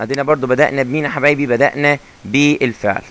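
ordinary talk, nothing marked

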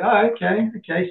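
a boss taken aback, lightly played; mildly surprised boss; mock surprise. Boss reacts to answer.

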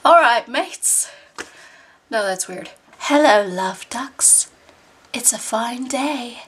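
slow, drawn-out attempt at a British accent. Shown as a demonstration. Canadian vlogger trying out British phrases, mock British accent. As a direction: put on a British accent, stretching each phrase.

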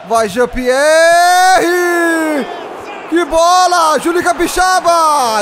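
high, loud stretched cheer; excited shout. Real, not acted. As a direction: yell him on toward goal. Near goal chance in game. (grito empolgado)

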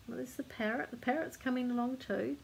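ordinary delivery

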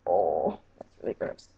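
whispered, grossed out; disgusted whisper. Real, not acted. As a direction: breathe it out in disgust. Wiping off the goop.

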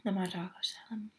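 eating a burrito; chewing while talking. Real, not acted. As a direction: mouth partly full of food. Seen 0: holds bitten burrito, lips closed and working between phrases.

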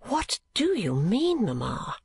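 slow, high, surprised question; evident surprise. A narrator's reading. Daughter puzzled by her mother's hints, surprised. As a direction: ask slowly, voice swooping up in surprise. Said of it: "demanded Kate in evident surprise".

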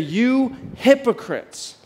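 "You hypocrites" drawn out high and slow; Jesus rebuking. Retold or imitated. Preacher voicing Jesus to the congregation, a slow high rebuke.

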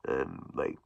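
normal talking voice

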